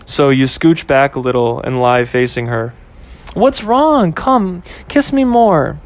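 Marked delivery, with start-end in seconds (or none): 3.3-5.8 put-on higher, coaxing girlfriend voice, slow at the end; girlfriend Ashley. Girlfriend coaxing boyfriend to kiss her more, higher and coaxing. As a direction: coax him back, pitched up.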